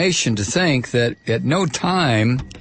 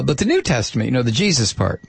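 ordinary talk, nothing marked

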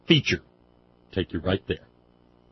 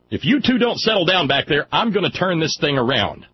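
exasperated parent voice, high and raised; raised, high-pitched mock threat from the driver's seat; scolding parent; 6 semitones higher, 3 dB louder than usual. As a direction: scold the bickering kids in the back seat. Mimics parent scolding squabbling kids.

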